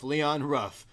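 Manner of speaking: normal talking voice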